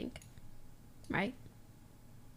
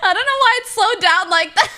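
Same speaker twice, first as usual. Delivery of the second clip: high, loud, words squeezed through laughter; laughing hard; 13 semitones higher, 7 dB louder, faster than usual. Real, not acted. Streamer amused by slowed translator, high laughing voice. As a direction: barely get it out for laughing.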